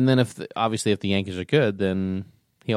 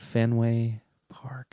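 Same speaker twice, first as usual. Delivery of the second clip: whispered reveal; 6 dB quieter, much slower than usual. Acted. Sly reveal of the venue.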